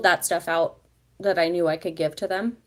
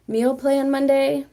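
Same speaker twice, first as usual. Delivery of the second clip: excited; 7 semitones higher, 7 dB louder, slower than usual. Real, not acted. Likes a suggested idea.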